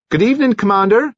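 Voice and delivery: high, quick and cheery hail; bright greeting. Acted. Nurse greets the limping commander, bright and high. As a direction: sing out a sunny good evening. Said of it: "she called out brightly".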